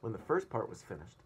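usual speaking voice

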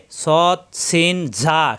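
"সৎ, সিন, ঝা" slow, with long hissing s and buzzing z; whistled sibilants. Shown as a demonstration. Teacher modelling three letters, "সৎ, সিন, ঝা" sibilant and slow. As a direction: whistle the first sound of "সৎ, সিন, ঝা". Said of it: "শীষ দিয়ে উচ্চারণ".

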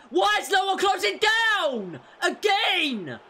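high, loud furious yell; angry shout. Real, not acted. Gamer screaming at his defence, furious. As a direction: bellow at players who won't defend.

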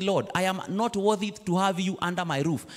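ordinary talk, nothing marked

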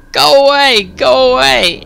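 put-on a child shooing off a nightmare; high, frightened girl; scared girl. Frightened girl pushes vision away.

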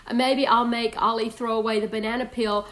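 usual speaking voice

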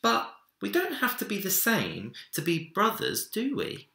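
put-on high child's question; hopeful little boy. A narrator's reading. Minik asks Big Bear if they can be brothers, high. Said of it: "laughed Minik".

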